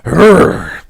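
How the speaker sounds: loud warm-up growl; mean growl. Acted. Psyching up to face the short lady, mean growl. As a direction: growl loudly to get into character. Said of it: "put on my mean voice".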